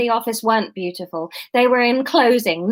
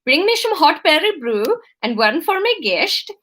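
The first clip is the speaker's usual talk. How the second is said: higher-pitched, put-on Dutch accent; bad Dutch accent; 5 semitones higher than usual. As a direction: give an order in a Dutch accent. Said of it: "integral to my Dutch accent".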